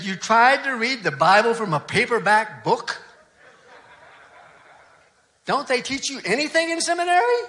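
outraged complaint, rising high on seminary; mock the old farmer's scandalised disbelief; indignant scolding. Old farmer scolding the young pastor, indignant. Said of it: "He was furious".

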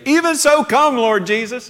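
high, loud eager cry; believers' eager cry, high and loud; eager longing. Retold or imitated. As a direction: call out as if longing for Jesus to come. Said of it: "you know, we say".

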